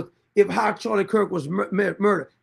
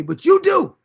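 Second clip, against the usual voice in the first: YOU DO jumps much higher and louder; pointed emphasis; 9 semitones higher, 6 dB louder, slower than usual. Real, not acted. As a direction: hit "you do" hard, accusing the other person. Scolding a commenter, pointed contrast.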